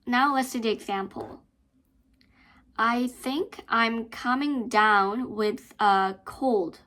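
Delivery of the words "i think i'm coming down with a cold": slow, words linked together; teacher modelling a sentence slowly; slow linked reading. Shown as a demonstration. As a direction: read it slowly, linking every word. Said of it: "you have to use linking and stress".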